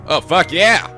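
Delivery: usual speaking voice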